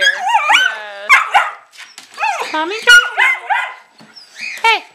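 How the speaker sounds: slow, high, loud cooing; drawn-out soothing coo to a pup. Real, not acted. Soothing puppies.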